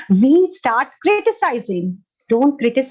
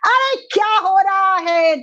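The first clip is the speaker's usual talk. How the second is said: imitated shouting; 7 semitones higher than usual. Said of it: "They keep on shouting".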